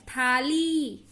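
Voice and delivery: "थाली" with a drawn-out final ee; long vowel. Shown as a demonstration. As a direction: hold the ending of "थाली". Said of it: "ई देर तक हो रहा है".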